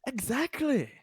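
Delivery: high-pitched eager exclamation; excited. Real, not acted. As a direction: squeal out your agreement. Enthusiastic agreement with joke.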